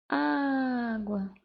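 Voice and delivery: "água" with a long, dragged first "á"; stretched stressed syllable. Shown as a demonstration. Teacher showing the tonic syllable, first "á" drawn out in "água". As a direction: hold the "á" of "água" as if calling it out.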